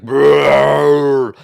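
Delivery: loud, rolling giant brrr; booming rumble in giant tongue; rumbling giant growl. Acted. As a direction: let a giant rumble roll out. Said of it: "horrible rumbling language".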